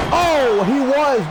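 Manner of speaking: normal talking voice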